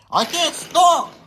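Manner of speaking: high, mock cry of help; mock scream for help. Donald, unable to stop, calling for help in a mock scream. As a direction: call out like a runaway engine. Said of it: "he screamed".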